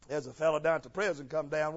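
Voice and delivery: ordinary talk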